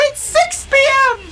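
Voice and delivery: squeaky troll. Acted. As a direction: squeal it out like a tiny creature. Third troll answers.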